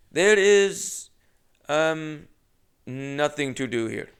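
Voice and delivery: put-on high start, slow Indian-accented deadpan; Indian accent impression. Clerk telling the comic there's nothing to do, Indian accent. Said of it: "unfortunately, keep doing it".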